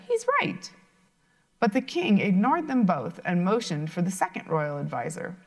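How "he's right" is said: put-on very high little girl voice; squeaky eager young princess; cartoon princess. Princess chimes in.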